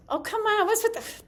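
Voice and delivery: high, loud exclamation of disbelief; exasperated outburst. Real, not acted. Actor interrupted by balloons mid-lesson, exasperated. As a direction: break off in sudden high-pitched exasperation.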